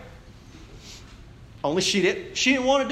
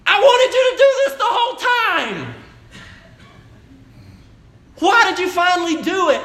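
high and loud, laughing through the words; half-laughing, delighted exasperation; laughing disbelief; 8 dB louder than usual. Retold or imitated. Wife laughing at husband finally doing the budget, high laughing voice.

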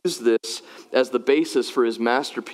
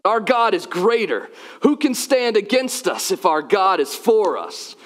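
high, emphatic proclamation; impassioned preacher's declaration; preached lyric recital; 7 semitones higher than usual. Acted. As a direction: declaim it to the congregation.

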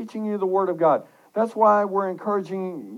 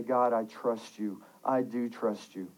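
low, soft affirmation of trust; quiet prayer; 7 semitones lower, 8 dB quieter than usual. Acted. Preacher praying through dry seasons, low and soft. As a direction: lower and soften it, speaking to God. Voices own prayer to God.

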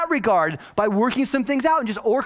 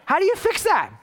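high mock question; 10 semitones higher than usual. Rhetorical question to congregation.

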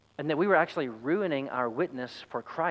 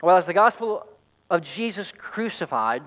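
ordinary talk, nothing marked

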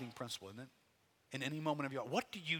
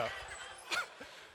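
airy laugh; 7 dB quieter than usual. Real, not acted. Laughs again.

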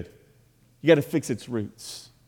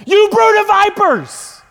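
very high, loud acted shout; explosive rebuke; 10 dB louder than usual. Preacher as Jesus suddenly turning on Pharisees, explosive shout. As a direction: burst out yelling at full voice.

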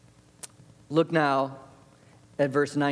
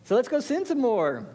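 careless mock voice; 8 semitones higher, slower than usual. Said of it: "They're like".

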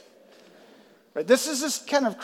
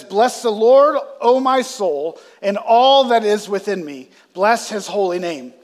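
loud proclaimed verse; scripture reading; 2 semitones higher, 9 dB louder than usual. Acted. Pastor rereads the opening verse to the congregation, loud. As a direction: call the verse out to the church.

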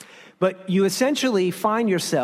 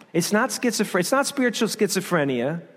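ordinary talk

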